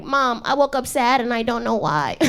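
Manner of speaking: a put-on whine, complaining to mom; mock whiny. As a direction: play it like a kid whining to mom.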